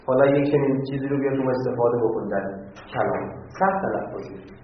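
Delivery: ordinary talk, nothing marked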